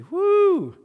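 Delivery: high, loud woo; long, high-pitched whoop; mock cheering whoop. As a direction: let out a big playful cheer. Mock cheer for neighboring town.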